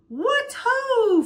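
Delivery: bright hail across a field; calling out. A narrator's reading. Farmer hails the running crowd, loud call. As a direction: call out a cheery greeting.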